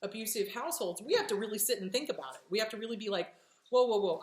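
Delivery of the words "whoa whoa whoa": громче, изображая испуг; louder, mock alarmed whoa. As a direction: stop yourself short, louder. Acting out catching oneself.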